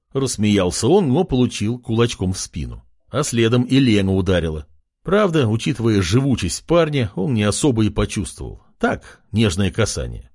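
usual speaking voice

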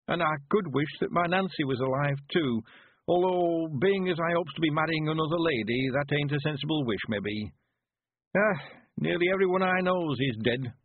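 put-on high rustic old-timer, mildly sorrowful; understated regret in an old man's dialect voice; old country man, wistful. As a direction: remember the dead as an old villager. Narrator plays Weevil remembering the dead.

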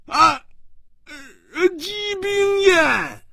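high, drawn-out cry of a name; astonished cry. Acted. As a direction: cry out in shock, voice leaping very high. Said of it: "他大叫着说".

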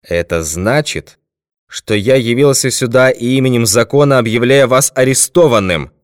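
measured opening, building to loud declaration; firm lawman's proclamation, rising in volume; detective announcing arrest. A narrator's reading. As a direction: declare the arrest with full official force.

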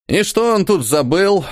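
high, slow displeased grumble; grumbling. A narrator's reading. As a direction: grumble it out, annoyed. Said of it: "недовольно ворчал подросток".

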